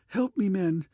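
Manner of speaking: a terrified emperor screaming to the guardsmen; breathless scream. A narrator's reading. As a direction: beg the soldiers with his last breath. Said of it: "he screamed breathlessly".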